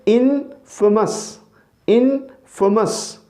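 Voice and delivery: इनफेमस very slowly, unstressed middle; slow model इनफेमस; correct pronunciation. Shown as a demonstration. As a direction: drill the right इनफेमस. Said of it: "हम क्या करेंगे".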